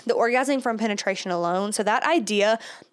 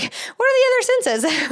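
high, loud, puzzled squeak; playfully lost, pitching way up; mock confused; 13 semitones higher, 5 dB louder than usual. Mocking herself for forgetting the senses, high puzzled squeak. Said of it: "I'm like".

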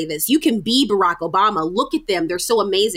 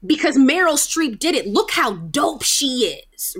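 higher-pitched, gushing praise of a star; mock hype; 6 semitones higher than usual. Society cheering on Meryl Streep's success, mock hype. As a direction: hype them up with emphatic, raised pitch.

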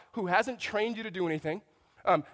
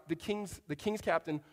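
normal talking voice

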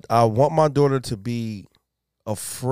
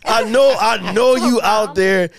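laughing reassurance; 8 semitones higher, 5 dB louder, slower than usual. Retold or imitated.

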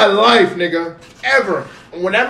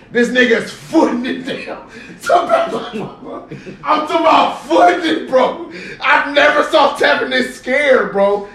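excited shouting; 6 semitones higher than usual. Real, not acted. Telling friends about his scared friend, excited shout. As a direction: shout it out, laughing in disbelief.

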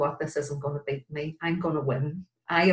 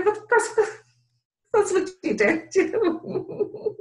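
laughing aside; 5 dB louder, slower than usual. Real, not acted. Laughing at own story.

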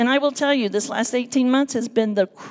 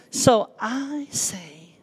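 whisper; much slower than usual. Real, not acted. Emotional testimony, voice drops.

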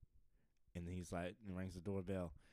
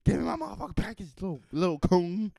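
very high, loud rant; mock furious demand; 10 semitones higher, 11 dB louder than usual. Comedian acts out neighbor demanding his package, mock furious. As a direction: explode in hammed-up rage.

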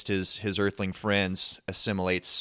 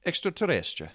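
higher, lilting attempt at Spanish that turns Italian; Italian-sounding accent; 8 semitones higher than usual. Shown as a demonstration. Co-host retries the Spanish word, comes out Italian-accented. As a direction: give it a sing-song Italian accent. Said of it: "That was more Italian".

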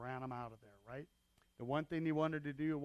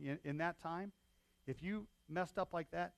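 normal talking voice

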